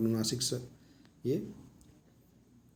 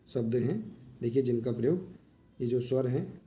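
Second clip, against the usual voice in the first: ordinary talk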